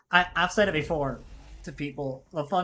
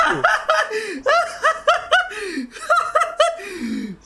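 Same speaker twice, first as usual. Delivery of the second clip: big squealing laugh; loud high laugh; 9 dB louder than usual. Real, not acted. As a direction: burst out laughing. Big laugh after insult.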